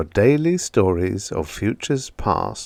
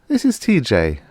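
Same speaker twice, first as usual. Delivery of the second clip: chummily; 6 semitones higher than usual. A narrator's reading.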